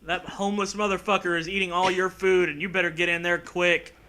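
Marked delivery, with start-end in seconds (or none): none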